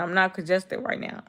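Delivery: ordinary delivery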